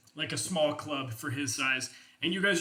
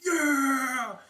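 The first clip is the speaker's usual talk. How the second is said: loud high cheering yell; crowd cheer; 9 semitones higher, 6 dB louder than usual. Acted. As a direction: shout it like a warband cheering. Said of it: "and you're just like".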